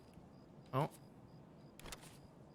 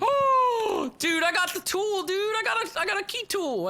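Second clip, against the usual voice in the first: much higher, louder thrilled yelps; excited; 5 dB louder than usual. Real, not acted. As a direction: shout the good news, thrilled. Finds a valuable tool.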